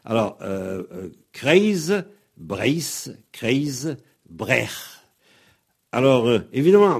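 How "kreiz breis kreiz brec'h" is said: "Kreis" as kreiz before "Breis" and "Brech"; "Kreis, Breis, Kreis, Brech" word by word; soft Z demo. Shown as a demonstration. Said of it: "le Z va être doux".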